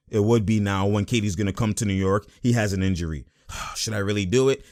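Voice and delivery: low, soft, worried owner musing to himself; imitating Dolan. Playing the Knicks owner fretting over paying for an injured star, low and soft. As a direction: mutter the owner's second thoughts low and quiet. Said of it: "Dolan's looking at it as".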